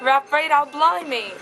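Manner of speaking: high, loud mock British accent. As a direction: exclaim in a hammed-up British accent. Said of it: "You can do the British accent".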